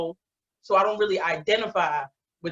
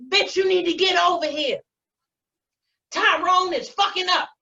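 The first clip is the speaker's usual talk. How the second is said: high-pitched whiny complaint call; imitated upset friend; 10 semitones higher than usual. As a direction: play the friend summoning help over man trouble. Acts out friend's urgent phone call.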